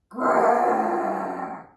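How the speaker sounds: loud growl. Acted. Ladybug scaring her friends, loud monster growl. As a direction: roar it like a monster in a log. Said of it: "They heard it again".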